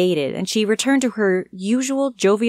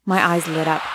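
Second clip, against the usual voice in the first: ordinary delivery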